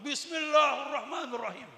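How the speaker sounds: high, loud, slow mock sermon opening; imitated student preaching. Student practising alone in the basement, hammed-up preaching. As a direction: ham up a lone student practising his sermon.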